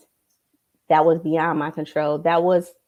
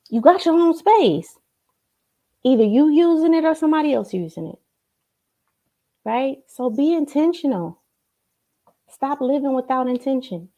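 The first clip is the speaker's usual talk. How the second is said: fired-up urging; 7 semitones higher than usual. Real, not acted. Coach urging the audience.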